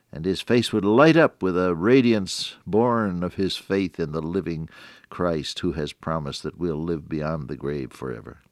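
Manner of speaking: ordinary talk, nothing marked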